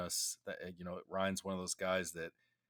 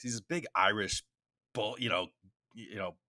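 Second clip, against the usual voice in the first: normal talking voice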